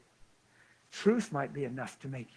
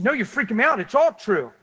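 high, loud mock alarm; freaked out; 8 semitones higher, 10 dB louder than usual. Preacher imitating girl shocked by his words, freaked out. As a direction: blurt it out in exaggerated panic.

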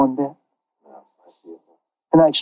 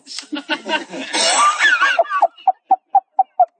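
long high laugh at himself; real high laugh; high cackling laugh. Real, not acted. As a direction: burst out laughing. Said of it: "laughs at own story".

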